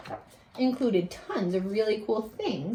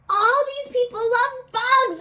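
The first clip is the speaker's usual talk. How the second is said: put-on lifted, eager girl's voice; a touch of an eager kid; excited girl; 13 semitones higher than usual. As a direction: say it with a child's delight, lightly. Girl character quoted in story.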